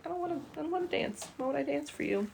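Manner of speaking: high, fast, put-on bird voice; high voice for the parrot. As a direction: voice the bird's diva refusal. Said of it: "He's like".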